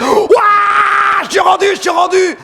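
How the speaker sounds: very high, loud triumphant cry; shout of joy. Retold or imitated. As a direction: burst out in relief at reaching land. Cri de joie à l'arrivée. (cri de joie très aigu et fort en touchant la France)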